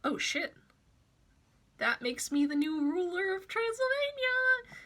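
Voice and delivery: a hint of a surprised teenager; mock Sonny voice. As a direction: lightly play the kid who just became king. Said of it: "he goes".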